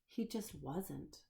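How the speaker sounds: plain reading voice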